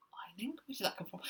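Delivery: hushed puzzled whisper; whispered aside. Real, not acted. Host whispering to herself about the odd word, bemused. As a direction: murmur it to yourself, bemused.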